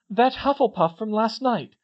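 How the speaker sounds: high, loud, urgent answer; alarmed announcement. Acted. Katie naming the victim to Ron, alarmed. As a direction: deliver shocking news urgently.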